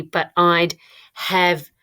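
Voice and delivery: "have" set apart after a pause; pause, then land firmly on "have"; stress on "have". Shown as a demonstration. Teacher putting in the missing word, "have" set apart. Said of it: "there's a missing word there".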